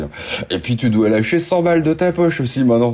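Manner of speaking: normal talking voice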